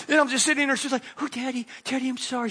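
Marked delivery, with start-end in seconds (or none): 1.2-2.5 apologetic daughter. Retold or imitated.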